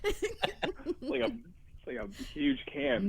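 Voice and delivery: laughing. Real, not acted. Laughing through line about huge can.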